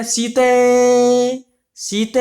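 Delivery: सीते drawn out slowly, the final vowel held; long calling out. Shown as a demonstration. Teacher showing how Ram calls Sita, drawn-out call. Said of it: "जैसे रामायण में बोलते हैं".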